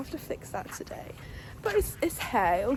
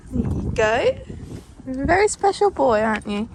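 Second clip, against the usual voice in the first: much louder, high sing-song praise; cooing praise; 3 semitones higher, 10 dB louder than usual. Real, not acted. Praising her special horse, loud high cooing pet talk. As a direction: gush over him like a beloved pet.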